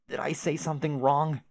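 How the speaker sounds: usual speaking voice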